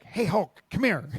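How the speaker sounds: high calling voice, hailing him across a parking lot; mom calling out. Retold or imitated. Mom calling out to Hulk Hogan in the parking lot, high hailing voice. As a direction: call out high to a big man walking away. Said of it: "And she's like".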